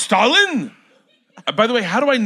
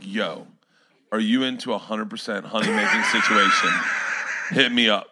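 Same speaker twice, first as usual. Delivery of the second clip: laid-back, lower, softer "yo" pitch; casual bro pitch; 9 semitones lower, 6 dB quieter than usual. Retold or imitated. Mock rewrite of the letter as a casual bro invite, laid-back. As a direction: slide into it like a smooth casual invite. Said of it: "if he was like".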